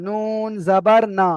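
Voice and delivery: slow নুন জবর না with the long না ending; teacher correcting the reading; corrective demo. Shown as a demonstration. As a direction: say নুন জবর না slowly, stretching the final না. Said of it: "ন নয়".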